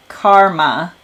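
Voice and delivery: "karma" slowly echoed back in an American voice; "karma" sounded out as the listener heard it; echoed pronunciation. Shown as a demonstration. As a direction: repeat "karma" slowly and distinctly. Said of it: "sounds to me like you're saying".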